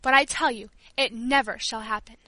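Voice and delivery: loud, rising fury; furious invalid shouting down; angry outburst. Acted.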